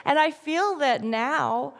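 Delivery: ordinary talk, nothing marked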